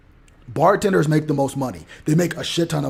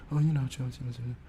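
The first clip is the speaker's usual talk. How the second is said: mumbled dodge; 3 semitones lower, 10 dB quieter than usual. Retold or imitated. Evasive mumble fading out.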